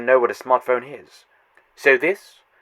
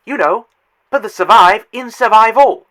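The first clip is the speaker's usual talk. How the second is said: gleeful pun; 7 semitones higher, 9 dB louder than usual. Acted. Villain's pun on survival.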